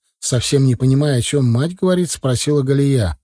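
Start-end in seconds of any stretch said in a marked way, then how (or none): none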